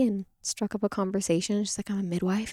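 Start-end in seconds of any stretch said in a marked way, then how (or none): 1.9-2.5 whispered, softer confession; whispered reveal. Retold or imitated. Midwife at a party quietly says her job, whispered. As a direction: whisper it like a secret.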